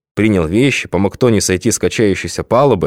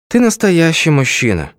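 sighing; 9 semitones higher than usual. A narrator's reading. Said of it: "вздохнула она".

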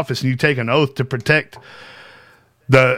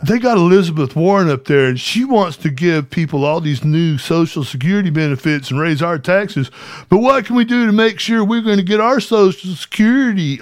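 higher, louder dopey fan voice; mock softball question; 5 semitones higher, 3 dB louder than usual. Streamer mocks the tame questions TPUSA wants, dopey. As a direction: play a clueless eager conservative student. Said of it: "than shit like".

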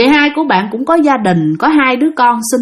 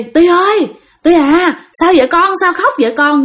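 family calling through a stuck door, higher voice; raised-pitch, concerned call to a child; worried calling out; 7 semitones higher than usual. Retold or imitated. Family outside the locked door asks the crying child, worried calling. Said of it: "mọi người mới đứng ở ngoài mới hỏi".

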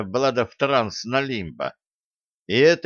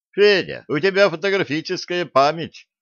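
raised, higher-pitched address; pitched-up call, loudest on the name; calling on someone; 6 semitones higher than usual. A narrator's reading. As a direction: call to a teammate, high and brisk at the start. Commander addressing one of his men.

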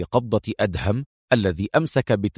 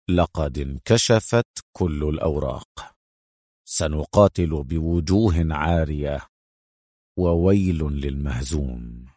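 grim resolve; 8 semitones lower than usual. A narrator's reading. Adham watching the police go, grimly resolved.

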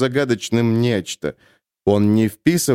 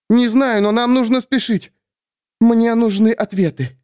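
put-on higher, urgent young heroine; young woman, urgent; 10 semitones higher than usual. A narrator's reading. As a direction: press it, tense and scared. Sulin voiced higher.

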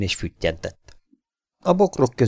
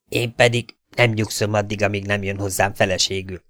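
ordinary delivery, nothing marked

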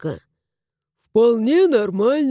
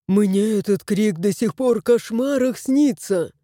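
usual speaking voice

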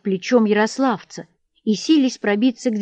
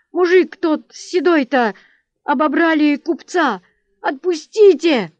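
high, halting frightened plea; pleading, voice trembling; trembling, breaking voice; 7 semitones higher, 5 dB louder than usual. A narrator's reading. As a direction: beg for release in a raised, broken voice. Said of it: "дрожащим, прерывающимся голосом".

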